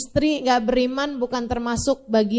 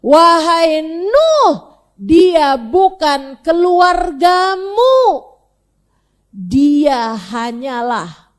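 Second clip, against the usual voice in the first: very slow, loud, drawn-out declaration; a preacher thundering the divine verdict; solemn divine pronouncement; 7 dB louder, much slower than usual. Acted. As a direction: proclaim each word with weight. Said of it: "Katanya Allah Subhanahu wa taala".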